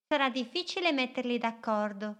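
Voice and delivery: a small boat dreaming big, spoken up high; little boat's voice. Acted.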